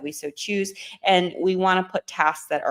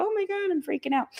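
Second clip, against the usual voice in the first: much higher, fast, hammed-up panic; mock freakout; 12 semitones higher, 4 dB quieter, faster than usual. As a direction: squeal it like a stressed-out student. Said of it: "we go".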